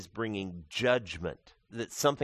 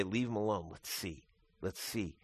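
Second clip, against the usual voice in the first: quiet mockery; 4 semitones lower, 10 dB quieter than usual. Bystanders mocking Jesus on cross.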